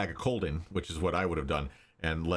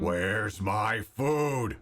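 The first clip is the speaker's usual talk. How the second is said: very slow, drawn-out dragon; much slower than usual. Acted. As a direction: drag it out like a hungry beast. Dragon demands its meal.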